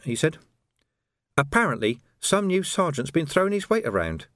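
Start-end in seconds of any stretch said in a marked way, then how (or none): 1.4-4.3 icy, higher-pitched indignation. A narrator's reading. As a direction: say it with cold, haughty disdain. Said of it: "in a voice of solid ice".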